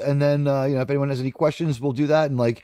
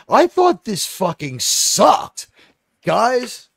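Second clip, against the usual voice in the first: slow, higher, leaning on every word; emphatic verdict; 5 semitones higher, much slower than usual. Real, not acted. Reviewer slowly hammering his verdict to co-hosts.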